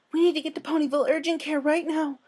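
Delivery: high, breathless, urgent plea; a timid mare voice pushed into a rush; high-pitched panicked Fluttershy at the door. Acted. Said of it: "Fluttershy quickly told her".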